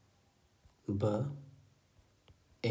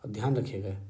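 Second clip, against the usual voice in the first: ordinary talk, nothing marked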